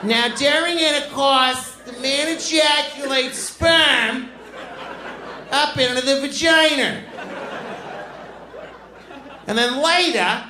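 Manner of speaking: put-on high, slow, strident Boston accent; Boston teacher. Boston teacher giving fifth grade sex ed, high slow imitation. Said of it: "She's like".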